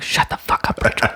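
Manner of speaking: soft whispered teasing; leaning over to tease Rachel, whispered. Retold or imitated. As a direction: lean in and whisper it. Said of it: "lean over ... be like".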